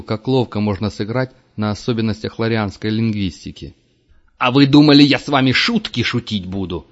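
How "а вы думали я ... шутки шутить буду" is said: much louder and higher, roaring; furious shout. A narrator's reading. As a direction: roar it in his face. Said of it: "заорал он". (яростный крик)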